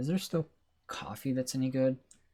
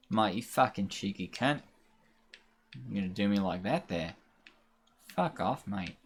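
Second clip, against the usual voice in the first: put-on half-hearted Australian accent, fairly low; bad Australian accent; 4 semitones lower, 3 dB quieter than usual. Gamer swearing at the game as an Australian, badly faked accent. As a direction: attempt a clumsy Aussie accent. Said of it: "That's a bad accent to try".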